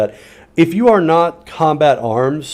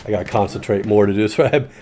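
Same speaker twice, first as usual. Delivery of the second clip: ordinary delivery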